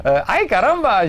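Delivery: slow, loud mock Mexican cry of pain; Mexican accent. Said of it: "j'ai mal en mexicain".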